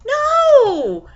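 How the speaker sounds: high, loud laughing "No!"; laughing shout. Acted. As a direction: squeal it out while dodging. Ducking away from mom's hands.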